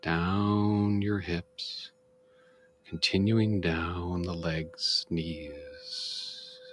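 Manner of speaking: slow, calm meditation guide voice with long pauses; guided meditation. Shown as a demonstration. Demonstrating guided meditation for viewers, slow soothing guide voice. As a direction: ease the listener down, body part by body part. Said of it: "example of how we do guided meditation".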